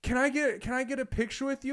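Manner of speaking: high-pitched pleading request; eager fan roommate. Retold or imitated. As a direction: imitate a giddy fan asking a favor. Roommate begs star for photo.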